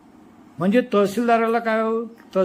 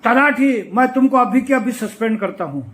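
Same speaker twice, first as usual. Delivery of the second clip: loud, higher official shouting a threat; retold shout; 4 semitones higher, 6 dB louder than usual. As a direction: bark the suspension at the clerk. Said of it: "तो SDO जोरानं ओरडला".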